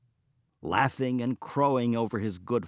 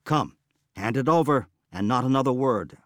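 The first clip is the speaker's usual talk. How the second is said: demanding; 3 semitones higher, 7 dB louder than usual. Acted. Dwarf demanding payment.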